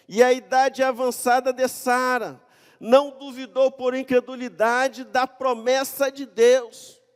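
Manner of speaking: loud, high-pitched declaimed Bible verses; preacher's ringing, emphatic reading voice; impassioned scripture reading. Acted. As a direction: proclaim the passage at full force. Reads scripture at full force.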